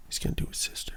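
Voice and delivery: hushed gossip whisper. Real, not acted. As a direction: whisper it like a scandal.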